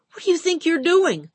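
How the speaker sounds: put-on high, loud, startled young clerk; voiced clerk caught off guard, blurting high and loud; startled clerk sputtering. A narrator's reading. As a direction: sputter it out in shock. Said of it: "the clerk had sputtered".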